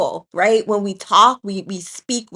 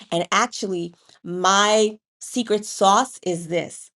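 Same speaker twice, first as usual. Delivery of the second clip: normal talking voice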